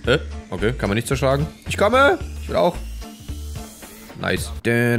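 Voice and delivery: ordinary delivery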